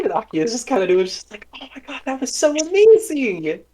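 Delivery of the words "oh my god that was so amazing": high, gushing wonder; amazed gushing. Acted. Akia praising Ennis's magic, high and gushing. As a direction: gush over the trick in awe.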